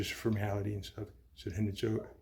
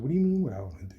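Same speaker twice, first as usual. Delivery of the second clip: loud, higher, disbelieving retort; incredulous shout; 4 semitones higher, 9 dB louder, faster than usual. Retold or imitated. Son shouting back at the doctor in disbelief. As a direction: throw his question back at him.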